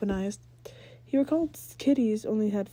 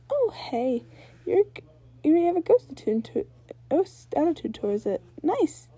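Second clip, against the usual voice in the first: much higher, fast excited praise; cheering; 8 semitones higher, 4 dB louder than usual. Acted. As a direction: cheer him on. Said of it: "Ember cheered".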